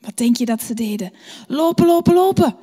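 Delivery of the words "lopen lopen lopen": higher, louder cry to run; urgent running call. A narrator's reading. Animals calling out to each other to run. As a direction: hurry them along.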